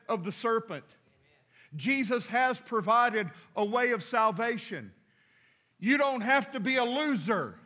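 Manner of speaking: loud, slow, high proclamation; fiery preacher proclaiming salvation; preaching at full force. Real, not acted. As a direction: thunder it from the pulpit. Preaching climax.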